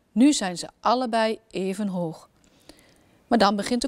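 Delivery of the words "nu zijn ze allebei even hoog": ordinary delivery, nothing marked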